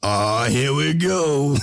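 slow, laughing resigned line; resigned mock sigh. Mock patient accepting the tranquilizer shot, slow and laughing. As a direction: drag it out with a laugh. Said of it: "you're gonna be like".

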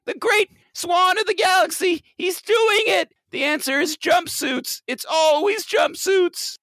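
high, fed-up voice; exasperated caller. Pretend. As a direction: throw up your hands.

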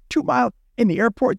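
retold incredulous reaction; incredulous question. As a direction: throw it back in disbelief. Retold disbelief at the counter's answer.

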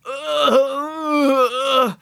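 pained overfull groan. A narrator's reading. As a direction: groan from eating too much.

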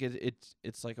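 ordinary delivery

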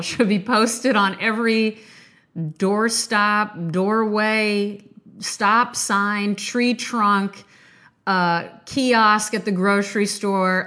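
usual speaking voice

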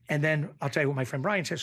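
normal talking voice

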